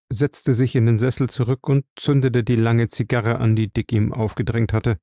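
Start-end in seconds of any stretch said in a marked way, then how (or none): none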